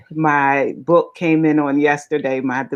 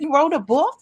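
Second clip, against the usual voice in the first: imitating the mailman; 12 semitones higher than usual. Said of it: "he said".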